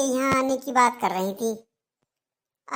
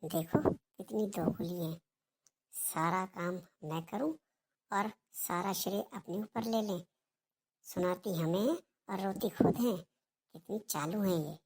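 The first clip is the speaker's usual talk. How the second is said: much softer, lower, slow muttering; muttered aside; 4 semitones lower, 11 dB quieter than usual. Acted. Daughter-in-law muttering about mother-in-law, low and slow. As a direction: grumble under your breath so she can't hear.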